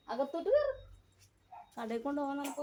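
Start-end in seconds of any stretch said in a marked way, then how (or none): 0.1-0.9 coaxing pet voice. Real, not acted.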